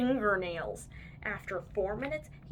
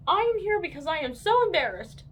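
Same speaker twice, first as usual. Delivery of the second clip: put-on embarrassed boy; 7 semitones higher, 5 dB louder, faster than usual. A narrator's reading. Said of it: "said Andrew".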